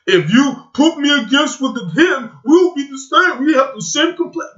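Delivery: high, mocking quote; mocking imitation. Mimicking Andrew's claim to look the same, mocking.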